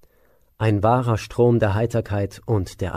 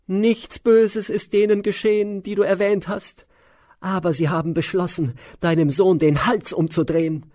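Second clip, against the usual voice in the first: high, loud, shrill servant voice; Mantara's shrill voice; 10 semitones higher, 3 dB louder than usual. Acted. Maid revealing the plot to the queen, high and shrill. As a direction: hiss out the plot in a high screech. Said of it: "Mantara erwiderte".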